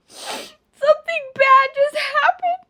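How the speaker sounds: high, slow, teary wail; mock sob as she breaks the news; crying voice. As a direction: whimper it through tears. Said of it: "And I was like".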